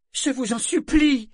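very high, loud desperate plea; imploring. A narrator's reading. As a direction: beg at the top of your voice. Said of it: "s'exclama-t-elle d'une voix implorante". (d'une voix implorante)

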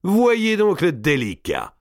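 high, slow jeer; mocking exclamation. A narrator's reading. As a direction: draw out the ridicule, pitched high. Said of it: "s'écria Gournay". (raillerie criée, traînante)

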